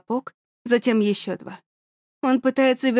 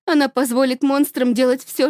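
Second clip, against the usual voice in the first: ordinary delivery, nothing marked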